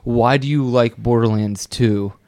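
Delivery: ordinary delivery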